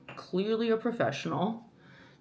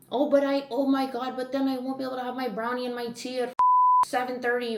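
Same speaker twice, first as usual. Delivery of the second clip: mock whiny self; 5 semitones higher, faster than usual. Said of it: "mocks her own excuses in a whiny voice".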